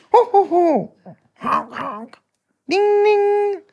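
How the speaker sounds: high cartoon ho-ho, honk and ding; imitated city noises. Host mimics the sounds of Sillydelphia, cartoonishly. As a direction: voice silly street sound effects, high-pitched. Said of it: "The sounds of Sillydelphia".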